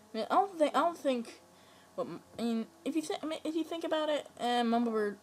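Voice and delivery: shouting excitedly at the screen; excited high shouting. Real, not acted. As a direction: blurt it out all worked up. Excited rant about Mama Bird.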